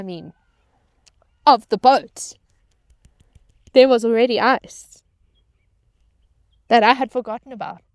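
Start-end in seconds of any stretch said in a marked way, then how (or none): 1.5-7.8 louder, higher exasperated outburst; loud exasperation. Real, not acted. Crew member exasperated at forgotten ice, loud outburst. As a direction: blurt it out, exasperated at yourself.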